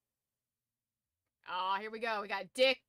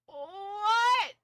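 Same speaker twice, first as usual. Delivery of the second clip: loud, much higher cry of disbelief; shocked; 10 semitones higher, 10 dB louder than usual. Real, not acted. Reading a nickname list, loud shocked cry. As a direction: yelp it in real shock.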